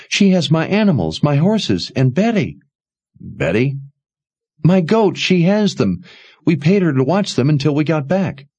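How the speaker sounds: put-on a frightened young woman pressing for help; upset young woman. Jensen voiced in upset.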